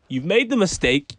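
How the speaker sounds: normal talking voice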